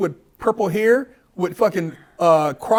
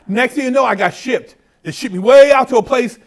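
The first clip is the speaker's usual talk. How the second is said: ordinary talk, nothing marked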